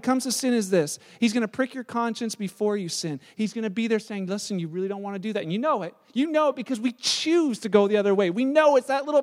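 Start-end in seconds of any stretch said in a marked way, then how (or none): 4.3-5.4 quiet warning. Retold or imitated. Holy Spirit voiced gently warning.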